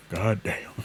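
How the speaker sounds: breathy whispered curse; soft whispered "god damn". Real, not acted. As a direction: whisper it in exasperation. Whispered exasperation.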